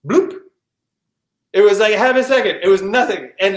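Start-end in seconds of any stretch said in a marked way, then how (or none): 0.1-0.4 high little rising bloop; a quick comic pop upward; imitated bloop. Showing how the shark's leap looked, high imitated bloop. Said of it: "and it looked like this".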